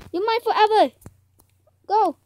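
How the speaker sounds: high, loud possessive shout; excited command. Real, not acted. Player claiming the donkey forever, excited command. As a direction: call it out high and forceful.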